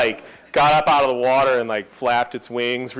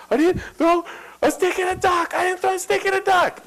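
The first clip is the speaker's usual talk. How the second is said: put-on much higher kid voice, insisting; four-year-old denying. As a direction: imitate a small boy's stubborn denial. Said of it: "This is what he said".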